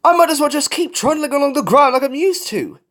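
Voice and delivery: high-pitched grumbling self-doubt; fretful high whinge; defeated moaning. A narrator's reading. Falcon moaning on, ignoring friends.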